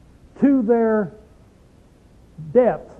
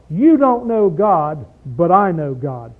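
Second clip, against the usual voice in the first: slow, stressing "You" then "I"; slow, firm defiant declaration; emphatic declaration; 3 semitones lower, slower than usual. Retold or imitated. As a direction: contrast "You" against "I". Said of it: "You go tell them".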